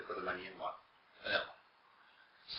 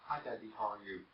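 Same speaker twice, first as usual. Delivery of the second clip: much softer, a quick hello to daddy; a hushed hi to daddy; soft aside greeting; 11 dB quieter than usual. Real, not acted. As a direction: turn away and greet him softly.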